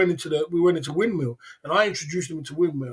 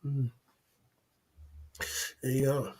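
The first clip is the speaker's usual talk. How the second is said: ordinary talk, nothing marked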